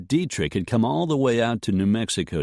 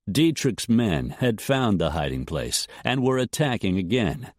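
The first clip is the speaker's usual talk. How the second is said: ordinary delivery, nothing marked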